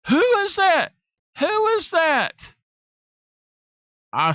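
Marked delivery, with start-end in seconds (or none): 0.1-2.5 high panicked squeal, slowing on the repeat; hammed-up panicked wife, high and loud; freaking out. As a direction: mimic her frantic panic, pitched way up. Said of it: "she's freaking out".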